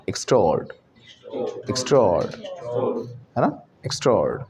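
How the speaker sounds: "एक्स्ट्राऑर्ड" as ik-STRORD, stopping on d; "एक्स्ट्राऑर्ड" with a break right after the d; syllable break demo. Shown as a demonstration. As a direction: say "एक्स्ट्राऑर्ड" as one merged chunk, falling. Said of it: "gap देगा डी".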